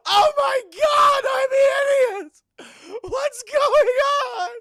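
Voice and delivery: very high, drawn-out shrieking wail; hammed-up scream of horror at your own reflection; horrified scream. Acting Cold Stone at the mirror, loud high shriek.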